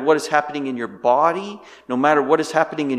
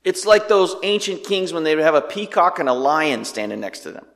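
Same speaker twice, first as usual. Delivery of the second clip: normal talking voice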